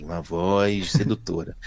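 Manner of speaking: low, slow, softened seductive announcer; imitating seductive voice. Host describing the airport announcer, mimicking her seductive voice. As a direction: draw it out low and sultry. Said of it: "E ela falava assim".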